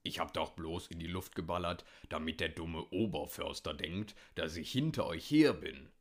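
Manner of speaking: put-on grumbling old hunter, low; Hüttemann. A narrator's reading. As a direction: defend yourself in a low grumble. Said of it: "brummte der alte Hüttemann". (brummiger alter Jäger, tiefe Stimme)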